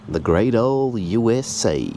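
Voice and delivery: put-on terrible American accent. Mechanic jokes about the Timken bearing brand, in a badly faked American accent. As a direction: drawl it out like a proud, bad American. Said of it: "That was a terrible accent".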